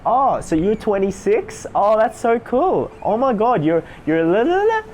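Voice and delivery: overexcited people-pleaser, pitch climbing at each end; rapport-seeking gush. Shown as a demonstration. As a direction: gush to win approval. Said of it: "it tails up at the end".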